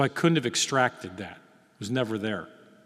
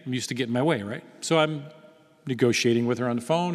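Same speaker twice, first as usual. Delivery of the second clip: ordinary talk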